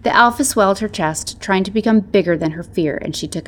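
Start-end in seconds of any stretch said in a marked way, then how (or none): none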